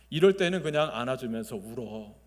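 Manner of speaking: ordinary talk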